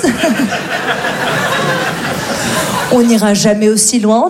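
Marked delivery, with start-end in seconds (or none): 0.0-0.1 voix très aiguë, lente, exaltée; militante imitée. Retold or imitated. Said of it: "Il y a une meuf qui était là". 0.2-2.9 éclat de rire soufflé; grand rire aigu. Real, not acted. Rire après l'imitation.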